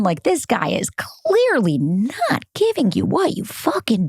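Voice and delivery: exasperated, drawn-out insistence; emphatic warning. Acted. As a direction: stretch the key words to drive it home. Voices emphatic warning about him.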